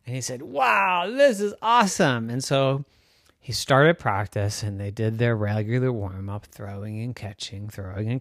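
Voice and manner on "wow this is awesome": put-on delighted boy.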